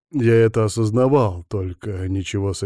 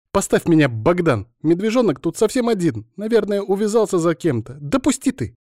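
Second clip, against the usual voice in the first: high-pitched, agitated female voice; freaking-out Alina; 9 semitones higher, 3 dB louder than usual. A narrator's reading. Alina demanding Bogdan put her down, agitated. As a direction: protest frantically in a high voice. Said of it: "принялась психовать Алина".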